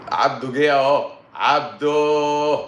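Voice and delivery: loud, drawn-out call of a name. Real, not acted. Host spots Abdo joining and calls out loud. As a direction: call to him across the room, stretched. Calling out to Abdo who appeared.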